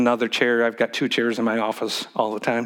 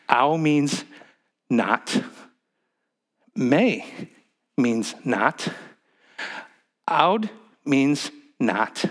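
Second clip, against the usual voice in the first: each word laid out slowly; slow down and spell it out for the class; slow emphatic teaching; much slower than usual. Shown as a demonstration. Pastor teaching Greek words to congregation, slow and deliberate. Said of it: "slow teaching of Greek words".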